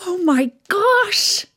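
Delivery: put-on high, slow amazed little girl; drawn out in delight at the new dress; amazed gasp. A narrator's reading. Daisy amazed as big sister.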